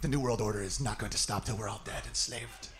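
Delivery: low, soft ominous voice; low hushed mock of a doom-saying truther. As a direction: drop low and grim. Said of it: "dark-ass truthers".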